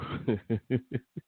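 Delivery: stifled private-joke laugh; amused, helpless chuckle; real laugh. Real, not acted. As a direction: laugh at what you nearly said. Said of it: "man, I'm laughing".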